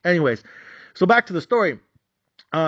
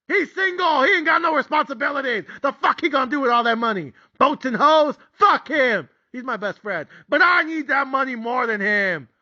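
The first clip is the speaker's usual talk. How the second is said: bitter frustration; 10 semitones higher than usual. Retold or imitated. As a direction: spit it out, jealous and desperate. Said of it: "my raw, honest, legitimate emotions".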